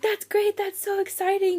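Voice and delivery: high-pitched imitation of an excited relative; delighted uncle. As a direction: imitate his thrilled congratulations. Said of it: "he jumped out of his chair".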